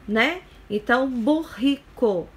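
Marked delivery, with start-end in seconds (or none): 1.1-2.3 "burrico" com RR forte, aspirated like an h. Shown as a demonstration. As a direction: say "burrico" with a strong guttural RR. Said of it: "Esse dois R's tem um som forte". ("burrico" com erre forte, som de h aspirado)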